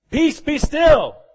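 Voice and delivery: high, loud and slow, a command to the storm; commanding rebuke. Acted. Preacher voicing Jesus commanding the storm, slow and loud. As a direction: speak each word slowly and with full authority.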